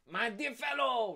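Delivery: high, loud character voice; haughty schemer. Acted. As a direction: patronise him in a high put-on voice. Acted Danglars addressing a drunk.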